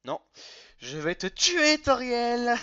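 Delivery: playful menace. Pretend. Threatens Toriel, genocide run.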